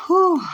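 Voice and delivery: high, loud whew of disbelief. Real, not acted. Recalling her crazy past, a loud whew. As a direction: blow out a big stunned whew. Reacts to her own wild story.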